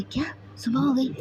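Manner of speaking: a quick hushed gasp of surprise; half-whispered disbelief that morning came; startled whisper. Acted. Siddharth, caught out at dawn, whispers in surprise.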